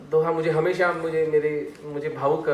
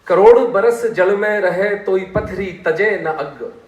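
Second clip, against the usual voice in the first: loud, slow declaimed verse; poem recitation; 3 semitones higher, 9 dB louder, slower than usual. Shown as a demonstration. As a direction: declaim the couplet, drawing out each word. Recites a couplet between explanations.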